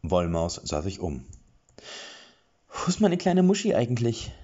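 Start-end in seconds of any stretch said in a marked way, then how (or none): 2.7-4.3 sorrowful voice. A narrator's reading. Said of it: "fragte sie mit trauerbelegter Stimme".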